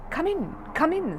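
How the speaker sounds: high, slow welcoming voice; man's voice. Acted. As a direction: welcome him in, slowly. Psychiatrist voice.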